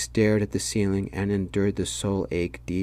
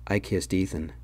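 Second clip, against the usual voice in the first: usual speaking voice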